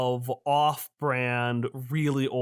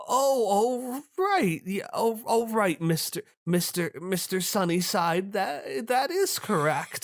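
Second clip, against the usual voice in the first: much higher, stammering fluster; flustered old lady; 6 semitones higher than usual. Acted. Donna nervously confirms to Mister Sunnyside, much higher and flustered. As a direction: caught out, stumbling nervously.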